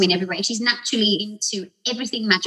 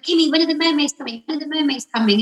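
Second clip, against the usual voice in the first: put-on quick, higher whiny child pestering Kimmy; nagging little girl; 6 semitones higher, faster than usual. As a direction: pester like an impatient ten-year-old. Annoying child assistant nagging.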